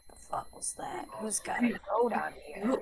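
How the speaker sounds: hushed unvoiced aside; whispered grumble. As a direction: mutter it under the breath. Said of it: "he's just like".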